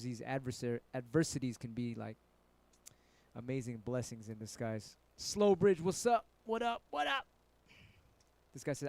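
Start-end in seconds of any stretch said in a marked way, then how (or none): 5.2-7.3 high greeting climbing higher; hyped shout-out. Real, not acted. Streamer greeting Slow Bridge in chat, hyped high shout-out. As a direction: call out a greeting, pitching each repeat higher. Calling out greeting to arriving viewer.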